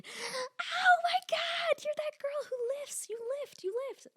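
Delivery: put-on drunk girl. Drunk girl at a frat party gushing over her, high squeal. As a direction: gush like a giddy drunk fan. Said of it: "when they would come up to me".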